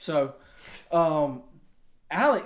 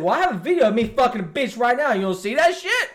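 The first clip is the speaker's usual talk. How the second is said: excited retort; 7 semitones higher, 5 dB louder, faster than usual. Retold or imitated. Boasting retort to roommate.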